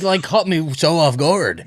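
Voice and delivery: loud, high, laughing hard. Real, not acted. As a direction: say it through a big laugh. Laughing about the show's recap line.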